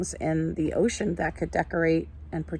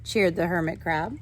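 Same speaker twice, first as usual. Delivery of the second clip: lively, sing-song storybook reading, higher than her chat; reading to children; 5 semitones higher than usual. Acted. Teacher reading a picture book to her class, animated storytelling. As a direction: read it like a picture book for little ones.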